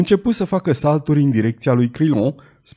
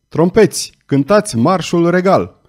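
loud, high battle cry; thunderous shout; 5 semitones higher, 4 dB louder than usual. A narrator's reading. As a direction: call the trumpets over the din. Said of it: "strigă cu glas tunător". (strigăt tunător)

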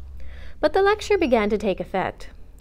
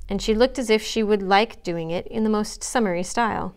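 ordinary delivery, nothing marked

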